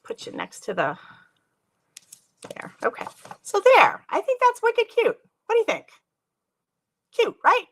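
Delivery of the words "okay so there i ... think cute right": much higher and louder, thrilled; excited delight. Real, not acted. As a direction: show off the card with glee. Showing off finished card.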